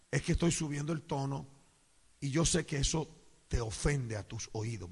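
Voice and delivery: low, gentle explaining voice; calm, quiet conciliatory reply; soft apology. Retold or imitated. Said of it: "le digo".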